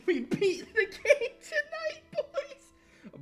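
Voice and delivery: high, loud laughter through the words; laughing hard. Real, not acted. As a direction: barely get it out for laughing. Laughing reading chat message.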